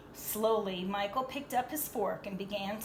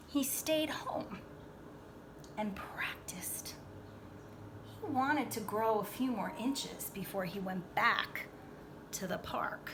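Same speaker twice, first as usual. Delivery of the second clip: whisper; 6 dB quieter than usual. Acted. Narrating in whisper.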